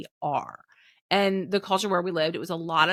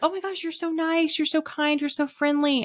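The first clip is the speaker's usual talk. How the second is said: much higher, effusive gush; gushing coworkers; 10 semitones higher than usual. Retold or imitated. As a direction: gush over her like an amazed new friend. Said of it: "people used to tell me".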